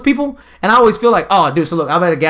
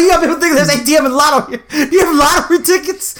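laughing indignant; 9 semitones higher than usual. Retold or imitated. Retold rant to the clerk.

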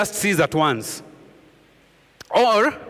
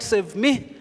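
a lightly voiced plea, pitched higher; desperate child's cry; 5 semitones higher than usual. Preacher voicing a child crying out to the Lord, mild plea. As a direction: plead like a child calling to a parent. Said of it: "A cry, so to speak, of a desperate child".

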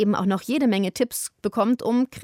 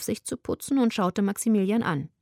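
usual speaking voice

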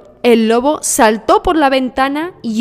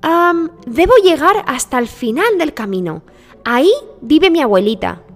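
put-on higher child voice with a smile; high, smiling storybook girl; smiling little girl; 6 semitones higher, 3 dB louder than usual. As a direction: answer sweetly as a little girl. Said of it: "dijo Caperucita con una sonrisa".

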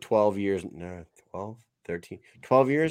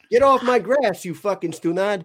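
high, loud hammed-up telling-off; mock angry scolding; 8 semitones higher, 3 dB louder than usual. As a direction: playfully chew someone out as a cranky character. Acting out Italian insult "Stunad.

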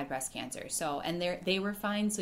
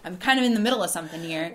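louder, fast, amused; a hammed-up busy brush-off; mock deadpan reply; 10 dB louder, faster than usual. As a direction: rattle it off for a laugh. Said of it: "you're gonna be like".

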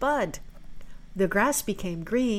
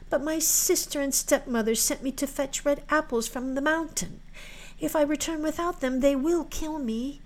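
put-on higher-pitched, earnest girl; pleading maiden; 6 semitones higher than usual. Girl tells January of the threat from home, pleading.

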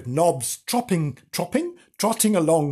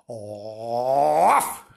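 bark; 5 semitones lower than usual. Acted. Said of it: "he barked".

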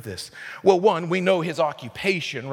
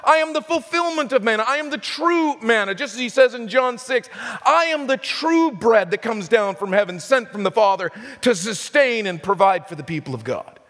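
preaching at full force; 4 semitones higher than usual. Acted. Preacher proclaiming to the church, at full force. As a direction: proclaim it to the congregation.

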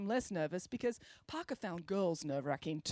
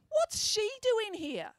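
high, slow stern teacher voice; 13 semitones higher, slower than usual. A narrator's reading. Teacher questions the girl's presence, high and slow. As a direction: demand it like a suspicious teacher. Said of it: "asked Mister Spencer".

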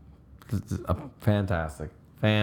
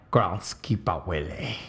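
put-on Scottish accent; 3 semitones lower, slower than usual. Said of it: "I wanted to get a Scottish one".

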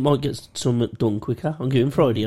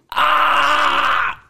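pained cry; 10 semitones higher than usual. Retold or imitated. Retold pain cry in dream.

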